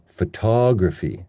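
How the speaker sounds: "photography" with strong TOG, schwas around it; stress on second syllable. Shown as a demonstration. Teacher pointing out schwas, TOG-stressed "photography". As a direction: reduce to fuh, hit TOG in "photography". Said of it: "these ones are schwas".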